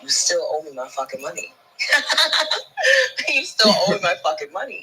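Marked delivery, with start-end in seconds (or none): 1.6-4.8 repeating the threat through real laughter; laughing. Real, not acted. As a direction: laugh your way through the line.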